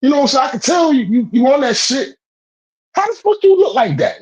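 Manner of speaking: can't believe how ripped he was; incredulous excitement. Real, not acted. To the friend, about the swole homeless man: high incredulous rant.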